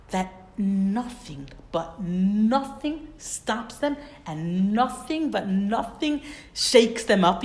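slow, each "nothing" stressed hard; emphatic repetition. Real, not acted. Teacher hammering home how hatred drives Bilam, slow and stressed. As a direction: lean on every "nothing" slowly.

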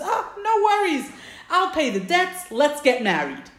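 high-pitched, breezy eagerness, retold; acted-out excitement, pitch jumping up; eager suitor. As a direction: play the keen groom brushing worries away. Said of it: "he's very eager and he's very excited".

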